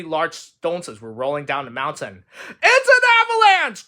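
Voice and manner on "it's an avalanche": very high, loud yell; alarmed cry. Acted. Toad warns Frog of falling stones, high alarmed cry. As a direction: cry out a sudden warning. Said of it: "cried Toad".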